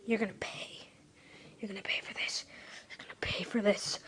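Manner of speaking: low, whispered threat; menacing whisper. Real, not acted. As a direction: hiss a quiet threat.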